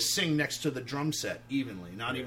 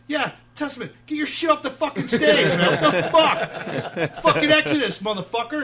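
much higher, much louder yell cracking into laughter; laughing mock yell; 13 semitones higher, 13 dB louder than usual. Tour manager yells at Testament through laughter, laughing shout. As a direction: bellow it while laughing at the absurdity.

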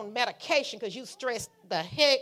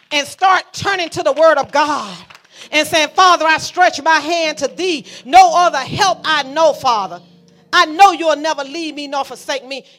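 fervent preaching prayer; 3 semitones higher, 10 dB louder than usual. Acted. Preacher lifting a loud prayer to the Father. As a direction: cry it out to God as a hymn.

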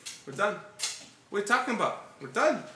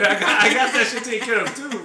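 louder, through laughter; laughing; 5 dB louder than usual. Real, not acted. Telling of his answer to the dad, laughing. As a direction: laugh your way through the boast.

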